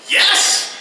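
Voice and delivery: loud delighted agreement; eager yes. Retold or imitated. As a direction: jump at the offer. Enthusiastic reply to robot threat.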